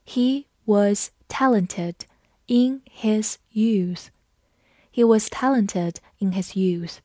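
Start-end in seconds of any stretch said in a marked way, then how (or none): 0.1-4.1 slow, the end almost whispered; slow teacher read-aloud. Shown as a demonstration. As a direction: read it slowly for learners. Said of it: "我们慢读一遍".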